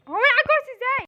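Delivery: loud, high, hurried outburst; rising panic. Acted. New doctor stuck on the chair, loud panicky outburst. Same question, escalating in panic.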